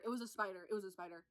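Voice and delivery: hushed, sinking echo; fading repetition. Retold or imitated. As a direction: let the horror drain down to a mumble. Repeats spider line, fading away.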